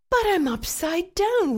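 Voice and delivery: high, loud whiny wail. A narrator's reading. Boy in the tree wails to the parrot, high wail. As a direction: wail it like a scared child. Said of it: "wailed Jeffy".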